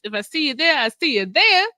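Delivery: high, lilting send-off; sing-song it up high; playful sign-off. Pretend. Host signing off to go panel-hopping, playful.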